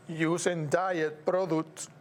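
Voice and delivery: ordinary talk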